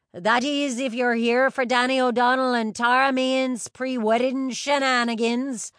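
high, loud hearty announcement; old captain announcing. A narrator's reading. Captain summoning guests for pre-wedding shenanigans, calling out loudly. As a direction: call it out to the whole crowd.

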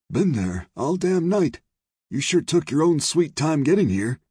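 high, loud grumbling saloon owner; dark complaint. A narrator's reading. Saloon owner scolds the late marshal, darkly. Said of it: "Carlyle said darkly".

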